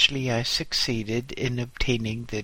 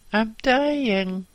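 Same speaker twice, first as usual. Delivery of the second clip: pained cry; 11 semitones higher, 5 dB louder than usual. Acted. Injured boss pleading.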